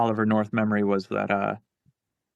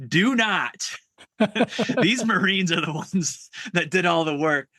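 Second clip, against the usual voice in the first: higher, hammed-up commanding bark; a higher mock-angry officer telling a guy off; mock Oliver North yell; 7 semitones higher than usual. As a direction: play the colonel shoving someone out of the shot. Oliver North shoving SF guy away.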